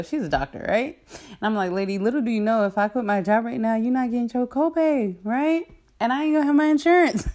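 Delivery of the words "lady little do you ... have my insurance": rising higher and louder, mock-incredulous; sassy aside. Speaker to the doctor, sassy and incredulous, pitch climbing. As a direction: talk back to the lady, getting higher and louder.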